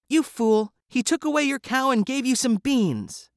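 high, loud scolding mother; mother shrieking at her son over the beans; angry mother. A narrator's reading. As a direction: scold the boy for his foolish trade. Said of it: "Jack's mother was very angry".

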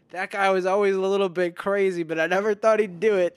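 loud, high boss voice; pompous boss impression. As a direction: boss recalling a local with loud disbelief. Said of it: "hearing my boss be like".

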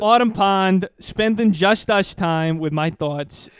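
slow, hammed-up New York accent. Shown as a demonstration. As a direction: read it like a New Yorker. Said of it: "I'm gonna read this in a New York accent".

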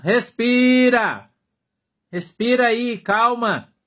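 Respira stretched slow and loud, pitched high; insistent call to breathe. Shown as a demonstration. Pastor urging a hot-headed brother, drawn-out loud Respira. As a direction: belt out a long Respira. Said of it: "Eu falo, Irmão, respira".

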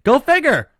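high, loud, knowing scoff; sarcastic go figure. Real, not acted. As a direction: toss it off with sarcasm. Sarcastic reaction to Cam Newton news.

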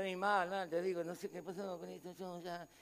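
imitating a discouraged believer, low and quiet; mock despondent. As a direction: sound like someone who has given up. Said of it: "No, diciendo".

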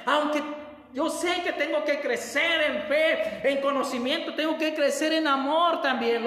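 loud, high rejoicing prayer; joyful prayer. Acted. Believer praising the Lord in church, loud and joyful. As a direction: pray out loud with joy.

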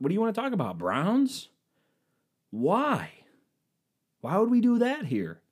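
high, bright party-chat voice; hammed-up cheerful guest; mock chirpy small talk. To wedding guests, a high mock-cheery question.

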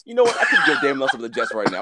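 ordinary talk

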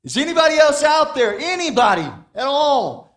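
loud frantic shout for any other rescuer; crying out. Acted. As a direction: hurl it across the canyon, begging anyone else. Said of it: "this voice crying out through the canyon".

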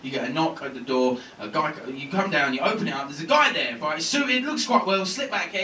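ordinary talk, nothing marked

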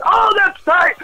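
high shouted delight, fan gushing; excited fan reaction. Retold or imitated. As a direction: squeal it out like a thrilled fan. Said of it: "Be like".

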